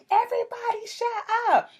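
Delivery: high mock yell; retold call for silence. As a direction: act out calling a whole room to order.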